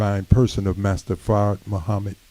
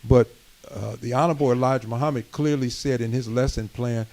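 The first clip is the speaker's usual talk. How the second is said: rising preaching; 3 semitones higher than usual. Acted. Minister exhorting the community room, preaching with rising force. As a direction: preach it to the congregation, building.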